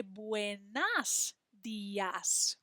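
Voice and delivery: buenas dias drawn out slowly as the mistaken form; slow wrong form. Shown as a demonstration. Teacher slowly showing the mistaken form buenas dias. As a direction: slowly offer the wrong version. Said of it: "looks like it should say".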